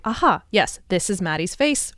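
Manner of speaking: usual speaking voice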